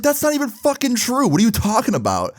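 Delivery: high-pitched indignant outburst, retold; retold exasperated objection in a high voice. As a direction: protest the lie, pitch shooting up. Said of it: "I raised my voice and swore at him".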